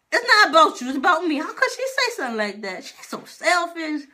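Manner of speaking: high, loud indignant outburst; high-pitched protest at a selfish remark. Real, not acted. Complaining about mom's selfish reaction, indignant.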